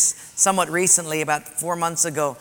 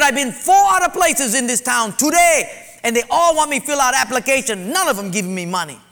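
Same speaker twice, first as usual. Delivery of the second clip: higher, louder retold outburst; angry shout; 5 semitones higher, 4 dB louder than usual. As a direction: shout it like a fed-up man. Angry man at church office.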